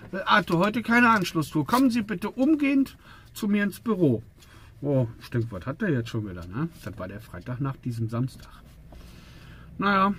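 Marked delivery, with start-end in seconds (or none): 0.1-4.2 the boss ordering him in, pitched up; imitating the boss. Boss summons him on the phone. (nachgemachter Chef, höher, bestimmt)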